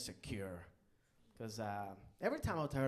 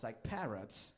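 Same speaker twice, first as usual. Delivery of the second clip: ordinary talk, nothing marked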